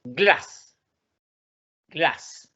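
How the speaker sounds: "Glass" with a short, quick vowel; short and concise. Shown as a demonstration. Teacher pronouncing the first word, short "Glass". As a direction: keep "Glass" brief. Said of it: "Corto y conciso".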